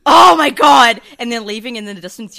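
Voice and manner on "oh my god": much louder, higher mock outburst; exasperated outburst. Reader erupting at a bad book, loud exasperated yell. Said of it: "just me going".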